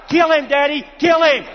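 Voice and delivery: put-on loud high childish shout; a boy demanding his party; sermon story, boy yells to have the turtle killed, shouting high; screaming child. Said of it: "the little boy began to scream and cry".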